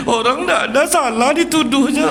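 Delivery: high loud tearful whine; crying child. Retold or imitated. Child scolded at school complaining to father, high tearful whine. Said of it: "ya baru dia nangis".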